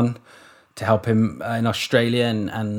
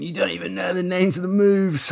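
higher-pitched imitation of a griping critic; mock complaint; 7 semitones higher than usual. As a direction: mimic an indignant complainer, pitched up. Retelling angry listeners' messages.

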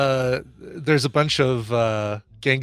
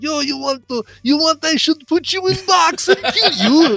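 over-the-top fake Russian accent, pitched high; horrible Russian accent; 14 semitones higher, 5 dB louder than usual. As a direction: ham up a bad Russian gangster accent. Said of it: "horrible Russian accent".

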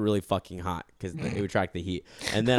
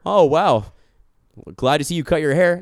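mock bouncer; 6 semitones higher, 7 dB louder than usual. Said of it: "bouncer or bartender".

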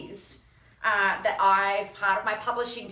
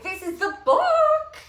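proud squeal; 7 dB louder, slower than usual. Real, not acted. Showing her book to viewers.

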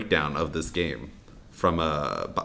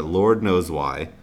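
ordinary talk

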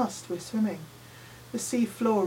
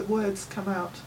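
usual speaking voice

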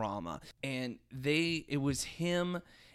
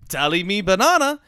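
put-on loud, jokey Jamaican accent; 8 semitones higher, 13 dB louder than usual. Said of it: "could you do a Jamaican accent".

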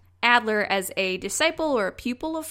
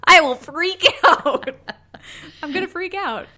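laughing; 7 semitones higher, 7 dB louder than usual. Pretend. Imagining freaking out at sideshow.

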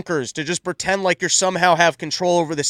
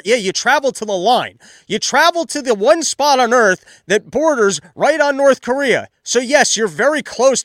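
exasperated shout; 6 semitones higher, 5 dB louder than usual. Real, not acted. Exasperated rant at Kamala.

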